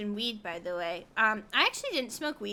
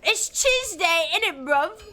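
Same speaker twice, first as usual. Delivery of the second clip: loud, drawn-out mock British accent; 4 semitones higher, 6 dB louder, slower than usual. Streamer mocking British speech to chat, hammed-up British accent. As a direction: put on a broad, teasing British accent. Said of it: "a British accent".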